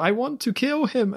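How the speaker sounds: imitating a terrible Bond girl line reading; mock bad-actress accent. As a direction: parody her badly acted accent, high and stilted. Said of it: "I can't even do the accent".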